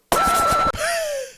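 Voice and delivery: a very high, loud burst of real laughter; uncontrollable laugh. Real, not acted. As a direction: let the laugh explode out of you. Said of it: "uncontrollable laughter".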